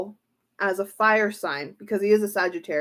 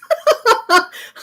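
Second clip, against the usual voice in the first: high loud burst of laughter; stunned laugh; 13 semitones higher, 8 dB louder than usual. Real, not acted. Reacting to the King of Wands card, a high loud laugh.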